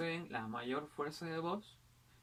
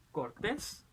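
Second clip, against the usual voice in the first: cortés with a raised TÉS; stress on last syllable; 5 semitones higher than usual. Shown as a demonstration. Teacher showing palabras agudas, cortés stressed on TÉS. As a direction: lift the end of cortés. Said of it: "en la última sílaba".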